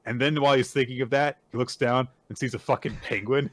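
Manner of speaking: ordinary talk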